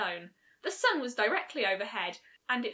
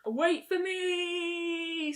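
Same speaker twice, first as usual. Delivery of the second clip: high, drawn-out call; shout; 7 semitones higher, much slower than usual. A narrator's reading. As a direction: call after them, slowly. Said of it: "Celestia shouted".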